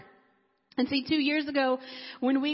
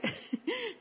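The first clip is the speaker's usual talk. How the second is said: short high-pitched laugh, lightly; a quick giggle at herself; 9 semitones higher, 7 dB quieter than usual. Real, not acted. Mother laughing at her own protest to God.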